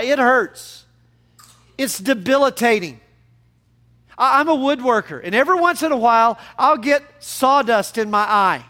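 impassioned preaching. Real, not acted. Preacher telling congregation how much it hurts, impassioned preaching. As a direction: preach it with force to drive it home.

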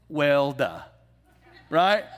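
ordinary talk, nothing marked

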